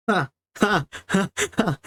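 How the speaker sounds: high, spaced-out ha-ha laugh. Real, not acted. Streamer reacts before greeting a friend, high laughing. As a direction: laugh in separate high bursts.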